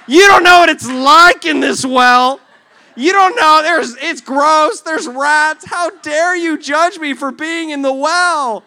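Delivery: hammed-up indignant shouting, high and slow; angry outburst. Trapped man to his rescuers, hammed-up angry shout. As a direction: shout it in drawn-out mock outrage. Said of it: "I get angry and say".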